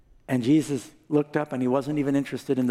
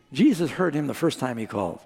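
ordinary talk

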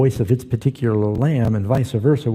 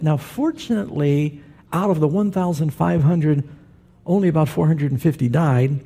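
ordinary talk

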